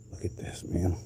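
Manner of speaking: breathy voiceless hush on look at this; whisper. Real, not acted. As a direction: murmur it close to the mic. Quiet aside showing shell.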